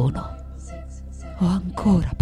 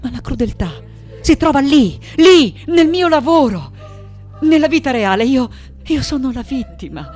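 high, loud, emphatic outcry; vehement insistence; 6 semitones higher, 5 dB louder than usual. Acted. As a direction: hammer it home with raised voice. Insists cruelty lives in her work. (insistenza veemente, a voce alta)

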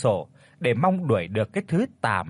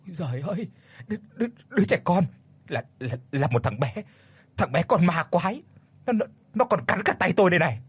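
fast, stuttering frightened cry; stammering terror; 5 semitones higher, faster than usual. Acted. Old man stammers to his wife about the ghost child. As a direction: trip over the words in fear.

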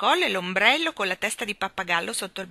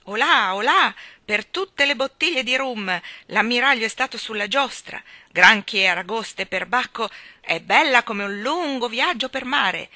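loud, hearty sailor bluster; booming admiral; 4 semitones higher, 9 dB louder than usual. A narrator's reading. The admiral greets the children, roaring. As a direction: roar it like an old salt. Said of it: "esclamò".

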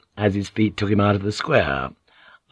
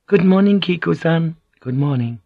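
high-pitched morning greeting; bright greeting; 7 semitones higher than usual. A narrator's reading. Maid greets Kiku in the morning, high greeting. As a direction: greet her in a lifted voice.

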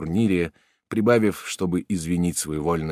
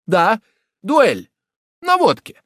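high, loud insistence; defiant confirmation; 9 dB louder, slower than usual. A narrator's reading. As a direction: hammer it home. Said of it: "продолжал Жак".

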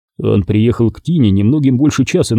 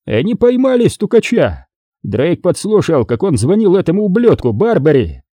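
high-pitched, excited announcement; triumphant; 8 semitones higher than usual. A narrator's reading. Boss reports the informer caught, triumphant. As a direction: crow over the catch.

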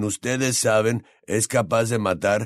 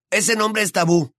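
higher, sharp warning roar; 10 semitones higher, 3 dB louder than usual. Acted. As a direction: snap the warning at his friend. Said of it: "bramó Ron".

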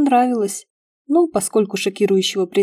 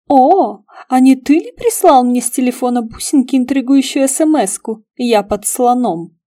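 louder, higher teasing interrogation; nagging, persistent; 5 semitones higher, 6 dB louder than usual. A narrator's reading. Petrik pesters Karavaev about a text message, teasingly persistent. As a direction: pester him, opening on a loud “О”. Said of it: "Не отставал от него дотошный Петрик".

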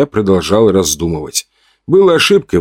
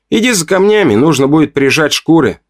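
higher-pitched, effortful order; visible effort; 7 semitones higher than usual. A narrator's reading. Man ordering the narrator to fetch stones, with effort. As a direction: force the instruction out. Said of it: "проговорил эти слова с видным усилием".

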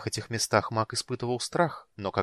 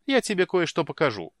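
eager; 8 semitones higher, 6 dB louder than usual. Acted. Sister eager to show.

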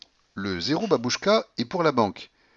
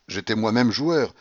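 ordinary delivery, nothing marked

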